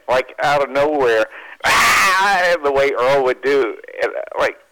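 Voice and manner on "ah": sudden high, loud "Ah!"; imitated scream. Storyteller imitating his boss's sudden outburst, screaming. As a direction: scream out of nowhere, imitating someone. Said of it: "he just starts screaming".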